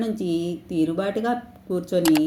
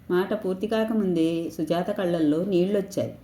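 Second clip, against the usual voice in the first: usual speaking voice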